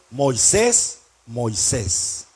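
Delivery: low, measured call by name; voice of God. Acted. God calls Moses in hiding, deep voice of God. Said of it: "Dios le dice".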